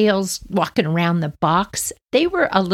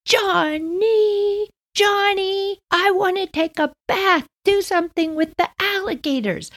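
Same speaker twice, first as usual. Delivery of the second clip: put-on much higher, childish whine to brother; little girl calling. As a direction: whine like a small sister. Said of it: "but I was like".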